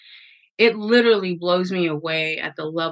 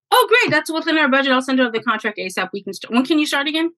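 excited client; 7 semitones higher, faster than usual. Retold or imitated. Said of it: "she's like, without hesitation".

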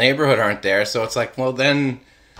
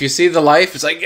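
ordinary talk

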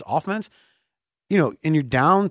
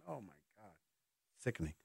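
quiet disgusted aside; 8 semitones lower, 24 dB quieter than usual. Real, not acted. Disgusted aside after his joke.

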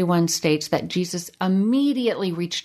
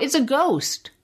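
a pitched-up, lightly acted yelp of alarm; mock cry of fear; 6 semitones higher than usual. Disciples seeing a figure on the water, mild mock scared cry. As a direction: read the disciples' terrified shout with a hint of panic. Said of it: "they cried out".

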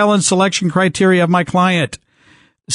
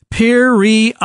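normal talking voice

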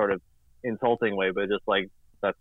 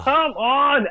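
high-pitched exasperated plea; hammed-up frustrated outburst; exasperated cry; 12 semitones higher, 4 dB louder than usual. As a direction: protest in mock despair, voice jumping up. Exasperated reaction to internet dying.

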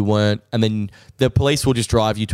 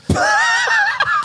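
shrieking laugh. Real, not acted. Cracking up at his own revenge plan, shrieking laugh. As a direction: burst into a squealing laugh.

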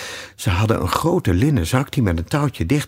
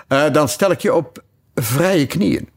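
king; 7 semitones higher than usual. Acted. King answers the hatter.

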